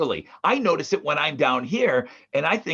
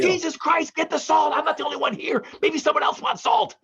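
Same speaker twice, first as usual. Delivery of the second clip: much higher, exasperated mock complaint; exasperated sister; 11 semitones higher than usual. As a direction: whine it like the fed-up sister at dinner. Retells sister's salt complaint again.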